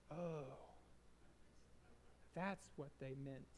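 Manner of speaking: soft, dawning realization; hushed, humbled understanding; quiet realization. Retold or imitated. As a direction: let it land, almost to yourself. Quiet realization after the group reminder.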